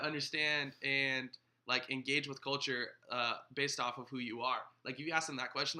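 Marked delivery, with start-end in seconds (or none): none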